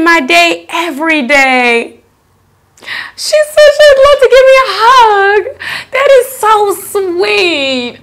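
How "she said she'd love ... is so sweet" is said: touched gush. Real, not acted. Fan wants to hug her.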